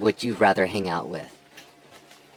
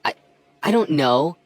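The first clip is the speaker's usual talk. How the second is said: usual speaking voice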